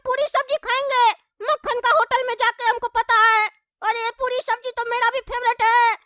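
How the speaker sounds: put-on high child's voice, scheming and hungry; a kid talking to himself about food. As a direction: play a greedy little kid plotting. Child character voice throughout.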